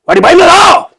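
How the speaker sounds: a huge high scream of వాడి భయం లేదా; villain roar. Shown as a demonstration. Actor auditioning as a villain, screaming the threat. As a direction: scream the question to terrify. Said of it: "ఒకసారి చెప్పండి ఆ dialogue".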